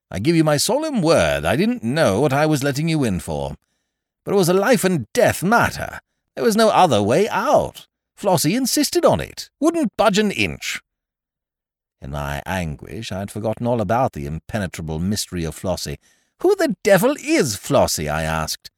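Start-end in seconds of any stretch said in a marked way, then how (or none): 0.1-10.8 high, loud, emphatic pleading from a hearty chum; earnest excuse-maker. Acted. Ukridge excuses himself to his wronged friend, pleading. 16.4-18.1 high, loud, baffled demand; exasperated question. Acted. Anguished visitor asks Ukridge about the mystery woman, exasperated.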